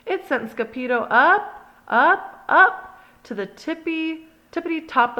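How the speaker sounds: climbing excitement. A narrator's reading. Narrator voices the flight up. Rising up in story.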